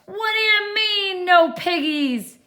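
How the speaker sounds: high, loud scolding witch; angry witch. Acted. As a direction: snap at the dizzy duck.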